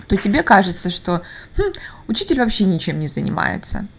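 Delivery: usual speaking voice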